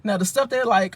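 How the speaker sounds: ordinary talk, nothing marked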